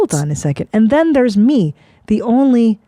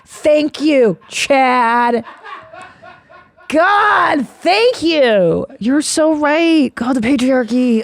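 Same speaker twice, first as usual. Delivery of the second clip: sarcastic thanks; 6 semitones higher, much slower than usual. Acted. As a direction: pretend to agree, dripping sarcasm. Mock agreement with sexist caller.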